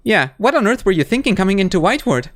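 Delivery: usual speaking voice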